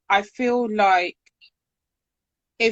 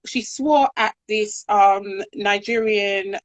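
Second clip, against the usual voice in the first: ordinary delivery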